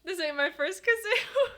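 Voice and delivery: high, tearful, laughing apology; laugh-crying. Real, not acted. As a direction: giggle through happy tears. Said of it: "I'm actually gonna cry".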